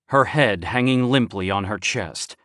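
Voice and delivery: usual speaking voice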